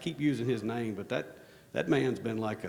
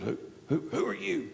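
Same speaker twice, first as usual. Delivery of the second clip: put-on suspicious old man, pitch rising to higher; gruff old man; 7 semitones higher than usual. Bearded old man at the back demands, suspicious. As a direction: demand who they are. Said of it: "he goes".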